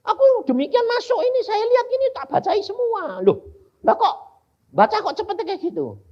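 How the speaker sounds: high and loud, astonished; amazed questioning. Retold or imitated. Preacher retelling his astonished questions to the Lord, high and loud. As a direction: ask in disbelief, voice way up. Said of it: "Loh Tuhan, engkau kok ndak bacain".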